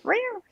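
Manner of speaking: high-pitched imitated meow; cat meow. Storyteller mimics the wary stray cat, high meow.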